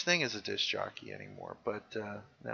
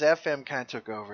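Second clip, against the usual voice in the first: normal talking voice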